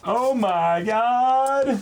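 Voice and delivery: very high, drawn-out oh my god; overwhelmed delight, stretched slow; squealing disbelief; amazed. Real, not acted. Shocked at the gift.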